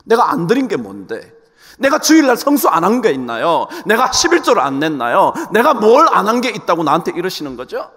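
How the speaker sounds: mild indignant protest to God; performed aggrieved outburst; acted rising anger. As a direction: complain as if unfairly treated, building in force. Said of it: "분노가 일어나는 거야".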